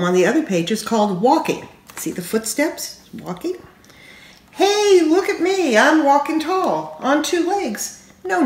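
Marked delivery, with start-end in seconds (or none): 4.5-8.4 high, bright call to be watched; proud little walker showing off; excited toddler. A narrator's reading. A toddler calling out proudly to the reader.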